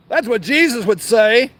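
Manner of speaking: ordinary delivery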